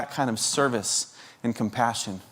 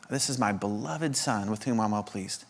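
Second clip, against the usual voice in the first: hushed father's blessing; 4 semitones lower, 9 dB quieter than usual. Retold or imitated. Quote repeated, softer still.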